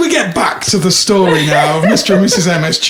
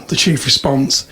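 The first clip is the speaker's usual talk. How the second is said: usual speaking voice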